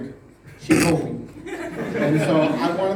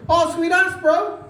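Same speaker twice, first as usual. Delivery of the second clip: higher, louder mock Maori English; imitating Maori brother-in-law; 12 semitones higher, 5 dB louder, slower than usual. Brother-in-law greeting the American guest, in mock Maori English. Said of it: "By the way, they're Maori".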